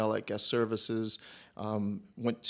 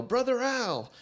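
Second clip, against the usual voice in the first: mock yell; 13 semitones higher, slower than usual. Stranger hailing the pastor in Home Depot, imitated high yell. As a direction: imitate someone calling out from afar, pitched way up. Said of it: "I hear somebody yell".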